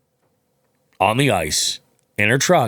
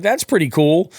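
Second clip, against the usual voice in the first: higher, admiring tone; impressed stranger; 8 semitones higher than usual. Retold or imitated. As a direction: chipper approving guy. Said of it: "He's like".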